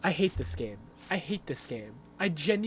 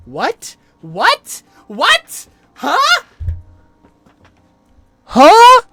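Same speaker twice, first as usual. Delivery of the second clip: incredulous cries climbing higher and louder; escalating disbelief; 19 dB louder, much slower than usual. Real, not acted. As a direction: blurt it out, each one louder and higher. Baffled by the game's answer.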